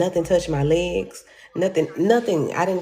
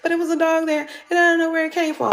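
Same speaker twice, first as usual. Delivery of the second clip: high, fast and louder, confused; dazed version of herself; 12 semitones higher, 4 dB louder, faster than usual. Retold or imitated. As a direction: blurt it out bewildered, pitched way up. Herself dazed, retelling crash.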